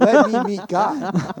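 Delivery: mock-reverent surrender, slow; slow awed declaration. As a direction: stretch each word like a sacred vow. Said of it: "I was like".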